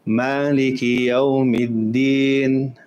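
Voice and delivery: Yawmiddin held for two counts; short two-count stretch. Shown as a demonstration. Teacher showing the shortest madd length. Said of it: "option to recite it two".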